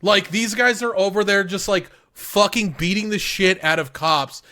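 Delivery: high, loud, fired-up rant; excited. Real, not acted. Cohost raving to his friend about the fight, excited and loud.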